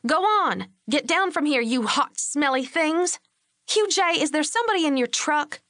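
high, sharp irritated shout at the dogs; irritable. A narrator's reading. Lori shoving the dogs away, irritably scolding them. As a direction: shoo the hot dogs off, annoyed. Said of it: "said irritably".

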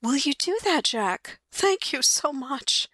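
light wife voice, politely asking; lightly voiced wife. Mary thanking Jack.

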